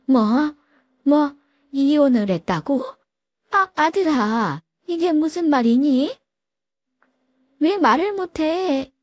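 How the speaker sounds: put-on an old mother hearing of her son's divorce; shocked mother-in-law. Mother-in-law hears her son divorced, shocked disbelief.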